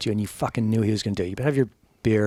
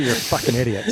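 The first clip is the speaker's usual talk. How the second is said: normal talking voice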